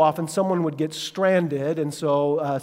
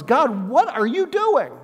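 slow, higher exasperated complaint; exasperated cry to God; 8 semitones higher, 4 dB louder, slower than usual. Retold or imitated. Believer complaining to God, mock exasperated. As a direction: throw your hands up at God. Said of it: "Your first response is".

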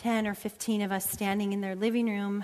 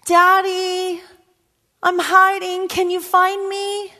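put-on high child's call, drawn out slow; girl calling her daddy; little girl's voice again, slower; 10 semitones higher, 14 dB louder, slower than usual. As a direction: repeat it slower, pleading a little. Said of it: "she says".

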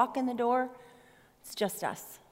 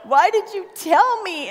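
slow, louder, higher mock sob of a little girl; crying child; 5 semitones higher, 6 dB louder than usual. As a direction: play the heartbroken seven-year-old, tearful and pleading. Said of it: "tears streaming down her cheeks".

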